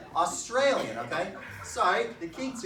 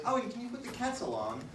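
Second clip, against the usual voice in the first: higher, softer British parent voice; British accent; 7 semitones higher, 5 dB quieter than usual. Acted. Comic quoting his parents at home, in a higher British accent. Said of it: "British accents".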